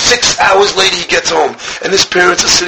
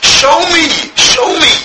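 high, pleading repeat; pleading cry; 9 semitones higher than usual. Acted. King David begging the King again, high and pleading. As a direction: beg again, higher.

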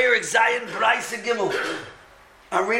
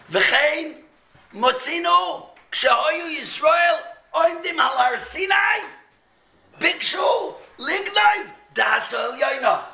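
excited proclaiming; 8 semitones higher, slower than usual. Acted. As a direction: cry it out like a revelation. Dramatic reading of the Tosefta.

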